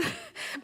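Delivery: short high laugh. Real, not acted. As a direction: laugh at your own outburst. Laughing at her own anger.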